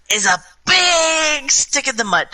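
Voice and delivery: put-on slow high shouted kid verdict; drunk child declaring. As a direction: proclaim it like a tipsy kid. Drunk kid judging grandmother.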